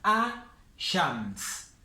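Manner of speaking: slow 'a-shams', the L left out; silent L. Shown as a demonstration. Teacher shows the written L goes unsaid, slow 'a-shams'. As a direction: say 'a-shams' with no L before the sh. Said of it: "pero no se pronuncia".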